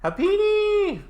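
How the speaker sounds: very high, thrilled squeal; excited squeal. Real, not acted. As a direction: squeal the name out with delight. Excited pull of a rare card.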